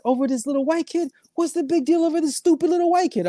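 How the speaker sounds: imitating an older man's Persian accent. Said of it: "obviously in his Persian accent".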